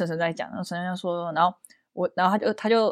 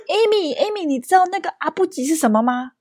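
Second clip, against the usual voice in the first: playful grandma; 7 semitones higher than usual. Retold or imitated.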